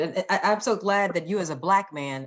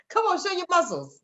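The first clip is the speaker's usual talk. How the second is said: laughing tease; 9 semitones higher than usual. Real, not acted. Host teasing the guest to flex, laughing. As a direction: laugh through it, higher and playful.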